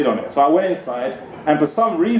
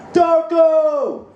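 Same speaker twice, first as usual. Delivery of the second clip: shouting a name; 13 semitones higher than usual. Retold or imitated. Guy in tank place shouts his name, mock yell. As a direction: call the name from above. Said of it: "he was shouting down at me".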